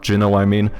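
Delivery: normal talking voice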